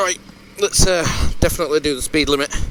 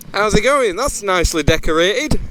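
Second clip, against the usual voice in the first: louder to be heard; raised voice over noise; 8 semitones higher, 4 dB louder than usual. Real, not acted. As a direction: call it over the engine noise.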